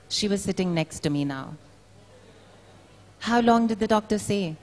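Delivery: measured literary reading voice; reading a story aloud at a book launch; story narration. A narrator's reading. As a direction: read it like a story to an audience. Reads her story aloud.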